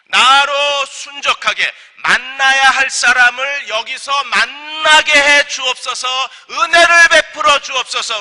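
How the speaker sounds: high, drawn-out pleading prayer; fervent prayer. Acted. Servant praying to God to meet the right person, fervent prayer. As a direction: plead loudly, stretching the key words. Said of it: "그가 이렇게 하나님 앞에 기도합니다".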